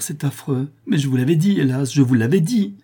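high-pitched, distressed reproach; anguished lament. A narrator's reading. Son to condemned mother in prison, anguished lament. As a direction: plead in rising anguish.

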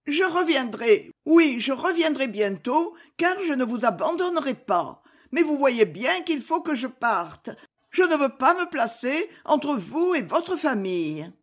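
voix féminine plus aiguë; femme éplorée. A narrator's reading. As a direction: plaider doucement pour son départ, voix haut placée. Clémence en larmes promet de revenir. (supplique de femme, ton aigu)

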